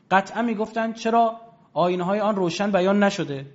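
ordinary talk, nothing marked

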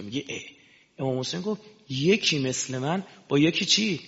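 normal talking voice

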